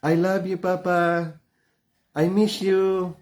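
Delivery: a hint of a child's tender voice; child's loving words. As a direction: coo it like a little kid missing Papa. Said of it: "when you say".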